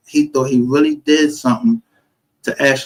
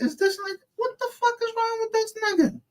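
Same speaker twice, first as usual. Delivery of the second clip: incredulous; 10 dB quieter than usual. Retold or imitated. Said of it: "I'm like".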